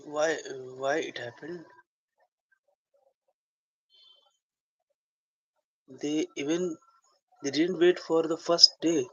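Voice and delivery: musing to oneself, puzzled; soliloquy. Shown as a demonstration. Actor alone, wondering why the group left, soliloquy. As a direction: think aloud in a private moment. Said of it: "I'm right now going to do soliloquy".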